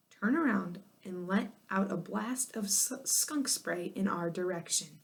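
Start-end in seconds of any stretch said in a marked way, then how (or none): none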